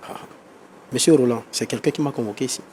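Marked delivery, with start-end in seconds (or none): none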